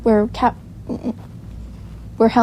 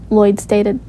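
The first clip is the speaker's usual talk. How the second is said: plain reading voice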